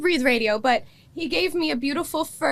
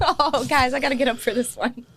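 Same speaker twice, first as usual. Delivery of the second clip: ordinary talk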